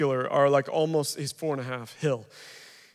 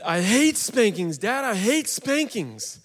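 a lightly voiced small son, complaining and slow; whiny little boy; 6 semitones higher, slower than usual. Son to dad after a spanking, a mild whiny child voice. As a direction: plead like a four-year-old who hates punishment.

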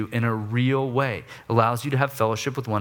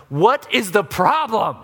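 slow, loud, higher demand; rude gripe; 7 semitones higher, 4 dB louder, slower than usual. Retold or imitated. Hungry dad confronting the store checker, loud and slow. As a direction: lean on each word, rude and impatient. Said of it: "I'm just griping at the guy".

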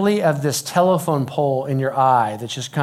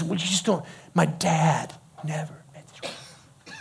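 deflated protest; 2 semitones higher, 6 dB quieter than usual. Retold or imitated. Challenged teenager repeating his complaint, deflated protest. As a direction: falter and trail off quietly in protest.

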